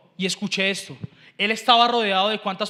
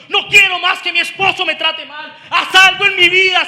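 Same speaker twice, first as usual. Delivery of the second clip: raised, insistent desperate plea; crying out in prayer; 5 semitones higher, 8 dB louder than usual. Acted. Preacher as one desperate over sin, crying out. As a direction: plead with God, hammering each refusal. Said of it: "dando voces, diciendo".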